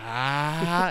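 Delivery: mock battle cry, sustained; power-up yell. Shown as a demonstration. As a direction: demonstrate a drawn-out power-up scream. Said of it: "that power up sequence".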